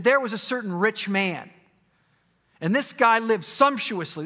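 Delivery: high, loud preacher's narration; projected parable storytelling. Acted. Preacher telling disciples' parable to a congregation, projected storytelling. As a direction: ring it out to the congregation.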